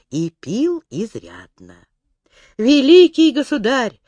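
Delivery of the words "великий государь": wailing plea. A narrator's reading. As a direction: cry out for mercy. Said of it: "вопил один толстый красный стольник".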